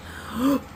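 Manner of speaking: a sharp high gasp of discovery; surprised gasp. Real, not acted. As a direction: gasp as if spotting something. Sudden idea, surprised gasp.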